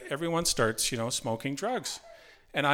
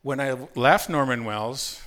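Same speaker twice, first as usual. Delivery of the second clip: ordinary talk, nothing marked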